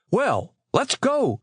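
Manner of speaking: high, drawn-out call to go; shouted. A narrator's reading. Chet hurrying his friends to the ice boats, mild shout. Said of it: "shouted Chet finally".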